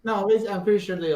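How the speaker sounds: usual speaking voice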